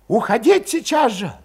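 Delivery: high, loud, pushing everyone to go; heated urging. A narrator's reading. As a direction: fire them up, urgent. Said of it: "возбуждающе горячился Валет".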